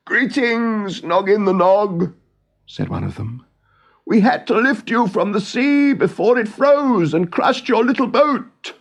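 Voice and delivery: put-on the Awp repaying an old kindness; great bird's voice. The Awp, to Noggin on the mountain: high, kindly bird voice.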